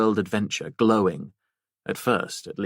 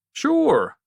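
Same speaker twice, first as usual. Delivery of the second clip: a much higher, louder landlord's sure; a quick, higher, louder reply as the landlord; landlord Harry; 5 dB louder than usual. Acted. Harry agreeing to Jen's coffee, higher and louder.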